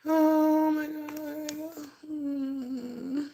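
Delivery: overwhelmed, on the edge of tears; real crying. Real, not acted. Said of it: "I'm about to cry".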